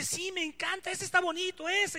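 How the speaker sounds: put-on excited young girl, high voice; Rosalía. Imita a la niña Rosalía emocionada. (niña emocionada, con voz aguda)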